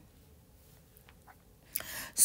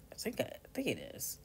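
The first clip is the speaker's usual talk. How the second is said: breathy whispered aside; 4 semitones higher, 11 dB quieter, faster than usual. Real, not acted. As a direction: whisper it as if to yourself. Unsure aside to herself.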